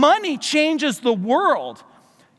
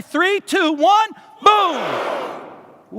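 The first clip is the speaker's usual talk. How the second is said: countdown shout; 5 semitones higher, much slower than usual. Real, not acted. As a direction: lead the crowd into a big shout. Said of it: "So let's try it".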